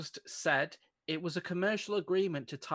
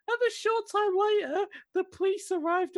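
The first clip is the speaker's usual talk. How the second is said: much higher, mock-dramatic newsreader; high, theatrical retelling; high dramatic reread; 14 semitones higher than usual. Shown as a demonstration. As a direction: replay the line high like a trailer voice. Rereads the line in a put-on voice.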